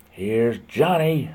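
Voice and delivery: slow, drawn-out, a light take on Nicholson; Here's Johnny. Retold or imitated. Streamer quoting Nicholson, slow and lightly menacing. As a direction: lightly mimic the Shining line. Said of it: "warned by Jack Nicholson".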